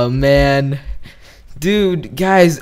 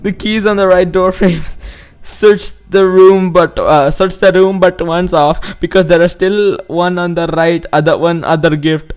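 put-on higher Indian accent reading; 4 semitones higher than usual. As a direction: read it in a raised Indian accent. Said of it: "in an Indian accent".

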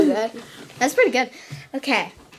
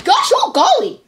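higher, goofy cartoon voice; Goofy impression; 8 semitones higher, 4 dB louder than usual. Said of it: "really good impression of Goofy".

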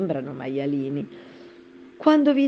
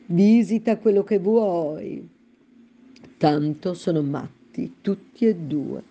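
slow, higher, drawn-out cat voice; Cheshire Cat voice; 5 semitones higher, slower than usual. Acted. The Cat answering Alice, slow and sly. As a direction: purr the directions lazily, amused. The Cat's voice.

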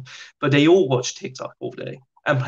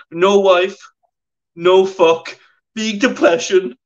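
louder, higher earnest pidgin; broken English detainee; 6 semitones higher, 6 dB louder, slower than usual. Retold or imitated. Chechen detainee explaining to Miles, loud broken English. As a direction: hammer the few words he knows.

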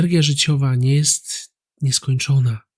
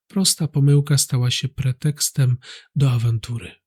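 normal talking voice

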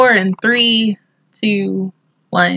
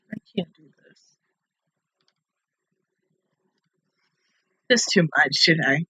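much softer, lower, near-tears voice; barely holding back tears; choked up; 7 semitones lower, 7 dB quieter than usual. Real, not acted. Viewer overwhelmed by a sad anime scene, choked up. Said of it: "I'm about to tear up over this".

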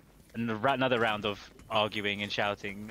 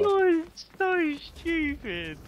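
much higher, very slow and drawn-out; teasing squeal; 5 dB louder, much slower than usual. Real, not acted. Mocking teammate who rifted badly, drawn-out teasing squeal. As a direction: stretch it out in playful disbelief.

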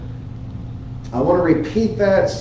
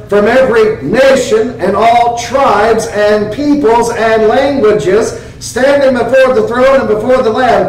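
raised, proclaiming reading; scripture reading; 3 semitones higher than usual. Acted. Preacher reading his favorite Revelation verse, proclaimed. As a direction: lift the vision up for the church.

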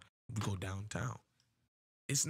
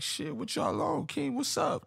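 much higher, slow, teasing call to the court; calling out the players, voice way up; hyped challenge; slower than usual. Retold or imitated. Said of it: "I'm like".

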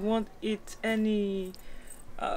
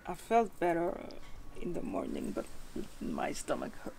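weak and tired; 2 semitones lower, 8 dB quieter, faster than usual. Real, not acted. Sick and tired after illness.

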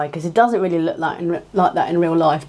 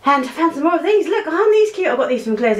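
excited show and tell; 10 semitones higher than usual. Real, not acted. Showing cute items, much higher.

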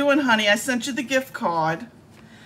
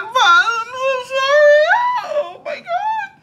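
mock sobbing; 7 dB louder than usual. Fake upset over used gift card.

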